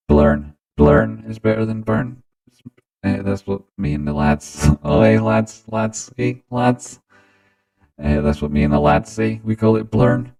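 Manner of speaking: robotic stepped-pitch voice mod; auto-tune. Shown as a demonstration. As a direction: talk through heavy auto-tune, locked onto notes. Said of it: "Well, how do I sound now?".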